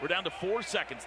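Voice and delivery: ordinary talk, nothing marked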